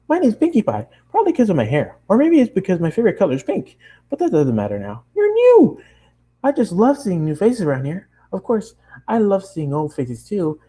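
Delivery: high, excited nonstop chatter; bubbly pony girl. Acted. Bubbly girl chatters at the new traveler, high and excited. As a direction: gush at the newcomer in a bright rush.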